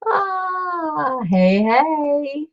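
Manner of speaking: a high squeal of delight; excited greeting. Real, not acted. Spotting Yo-Yo in the chat, she gives a squealing greeting. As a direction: greet a friend arriving in the chat.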